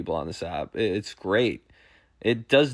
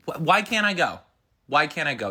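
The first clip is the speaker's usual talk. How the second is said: high, wounded acted whine; pleading, raised-pitch complaint; whiny protest; 4 semitones higher than usual. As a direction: whine at your partner in protest. Acted spouse whining about concert.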